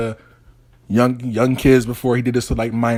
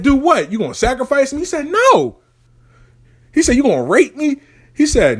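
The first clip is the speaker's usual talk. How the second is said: high panicky questions; 11 semitones higher than usual. Acted. Boy grilling the robed root worker, high and panicky. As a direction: fire off scared questions in a squeaky rush.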